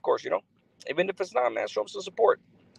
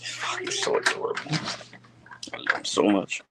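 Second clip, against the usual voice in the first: doting whisper. Real, not acted. Streamer gushing over his adorable pet, whispered. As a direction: breathe it out adoringly under your breath.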